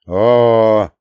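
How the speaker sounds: long, loud "oh" of approval; approving. A narrator's reading. Grandpa approving the correction as he pours, loud approving "oh". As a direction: hold the sound in hearty approval. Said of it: "одобрил он".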